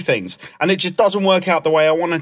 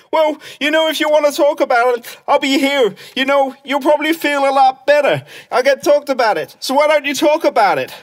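higher-pitched mimicry of a meddler; nagging concerned someone; 7 semitones higher than usual. Someone urging Clem to talk it out, pestering concern. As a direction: imitate someone pressing unwanted advice. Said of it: "And they go".

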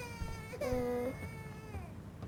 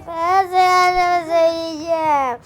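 put-on whiny baby threat; 4 semitones higher, 11 dB louder, slower than usual.

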